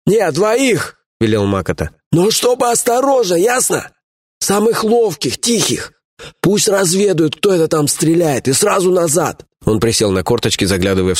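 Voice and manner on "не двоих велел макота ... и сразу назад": властный атаман. Acted. Атаман отдаёт приказ.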